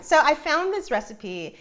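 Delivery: normal talking voice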